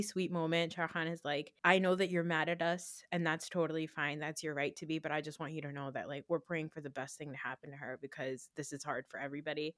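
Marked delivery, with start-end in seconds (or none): 1.6-9.7 soft, lowered voice in a retold quote; getting emotional. Retelling a really sweet movie moment, softly emotional. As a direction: sink quieter as it touches you. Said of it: "I'm, like, getting emotional talking about it".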